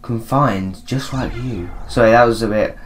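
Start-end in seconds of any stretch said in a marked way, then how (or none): 0.0-1.7 soft, halting ghost; ghostly voice. Acted. Ghost brought here against its will, softly ghostly. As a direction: speak softly with eerie gaps. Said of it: "I'm gonna do, like, a ghostly voice".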